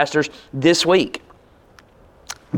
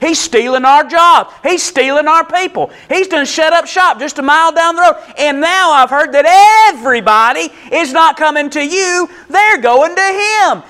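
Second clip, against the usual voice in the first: high, loud, indignant complaint; yelling in protest; 9 semitones higher, 5 dB louder than usual. Acted. Disciple complains to John about a rival, yelling indignantly. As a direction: cry out the grievance, voice pushed way up. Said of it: "like, yelling and screaming and being like".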